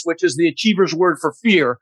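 usual speaking voice

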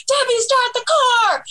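high, slow urgent call to the driver; urgent getaway call. Retold or imitated. Teen fan urging her friend to chase the limos, in a high urgent shout. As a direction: yell at Debbie to floor it, all stretched out. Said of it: "and I'm like".

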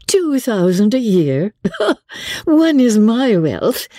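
high, slow amused disbelief; laughing. A narrator's reading. Eleanor amused at Edward's idea of a competence, laughing. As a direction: laugh through it, pitched high. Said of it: "Eleanor laughed".

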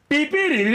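high, sung horn jingle; mock car horn tune. Friend arriving, imitating his car horn tune. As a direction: chirp it like a musical car horn.

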